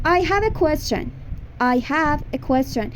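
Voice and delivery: slow teacher demo of the sentence; slow model sentence. Shown as a demonstration. Teacher modelling English for Persian students, slow. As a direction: say it slowly for repetition. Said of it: "من یه سوال دارم".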